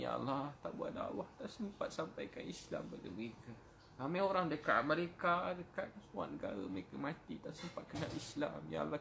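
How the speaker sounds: choked, pleading prayer with the pitch breaking upward; tearful pleading. Real, not acted. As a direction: beg God for mercy on the verge of tears. Tearful plea to God for forgiveness.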